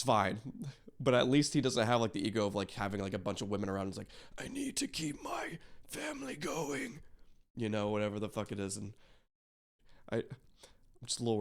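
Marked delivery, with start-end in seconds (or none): 4.4-7.0 high, whispery put-on voice, drawn out slowly; soft, slow, high-pitched mock plea; acted quote. Imagined quote of the man's motive.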